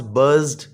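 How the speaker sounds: बज्ड closing on a voiced "d"; ending pronounced d. Shown as a demonstration. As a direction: finish बज्ड with a clear "d", no extra syllable. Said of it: "third है जी डी की आवाज़". (बज्ड के आख़िर में साफ़ "ड")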